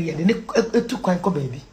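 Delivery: ordinary talk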